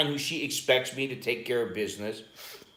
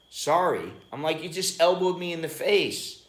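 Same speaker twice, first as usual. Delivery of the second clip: higher-pitched, incredulous retort; mock indignant protest; 6 semitones higher than usual. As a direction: replay an offended complaint to the wife. Acted quarrel after being elbowed.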